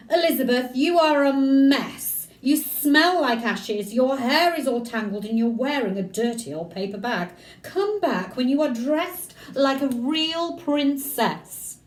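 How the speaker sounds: high, loud, slow haughty scolding; snooty prince. Acted. Ronald scorning the messy princess, high haughty voice. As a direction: look down your nose at her. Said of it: "he said".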